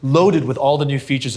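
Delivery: slow, loud, high product pitch; ad announcer. Acted. Host announcing the sponsor's phone to his guest, slow and loud. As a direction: sell the phone like a TV ad.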